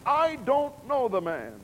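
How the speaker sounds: high, loud oath; sworn denial. Acted. Peter denying to the crowd, loud and high. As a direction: snap it out under oath.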